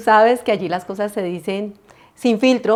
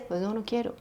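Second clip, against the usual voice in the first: normal talking voice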